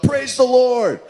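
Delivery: put-on the old lady calling from her porch; lady shouting. Elderly lady on her porch shouting praise, mock shout. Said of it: "stand out on her front porch and shout".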